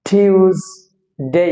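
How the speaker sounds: টিউজডে very slow, "thyooz", pause, "dey"; slow correct pronunciation. Shown as a demonstration. Teacher drilling the real way, slow correct pronunciation. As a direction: stretch টিউজডে in two beats with a z. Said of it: "আসলে".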